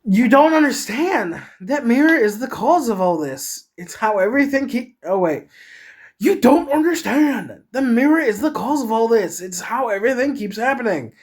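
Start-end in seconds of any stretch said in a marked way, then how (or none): 0.0-5.0 high, loud, frantic character voice; worked-up frantic protest; manic yell. Acted. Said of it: "I yelled, my voice turning manic". 6.2-11.1 very high, loud, frantic outburst; high-pitched frenzied protest; manic yell. Acted. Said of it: "I yelled, my voice turning manic".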